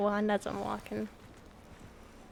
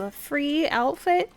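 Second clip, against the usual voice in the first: voice cracking; 5 semitones higher, 6 dB louder, slower than usual. Real, not acted. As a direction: voice cracks on a surprised question. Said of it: "Okay, voice crack".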